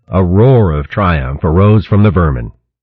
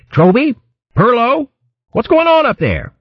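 high-pitched call to those ahead. A narrator's reading. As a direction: call up the line in a raised pitch. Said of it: "he called to the leaders".

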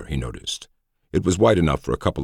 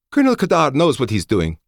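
high, loud commanding officer voice; crisp female officer, firm and high; female colonel voice; 12 semitones higher, 5 dB louder than usual. A narrator's reading. Colonel Schoen voiced by narrator.

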